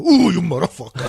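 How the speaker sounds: loud guttural foreman curse; throaty Sicilian foreman impression. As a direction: growl it out like an exasperated boss. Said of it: "Like guttural".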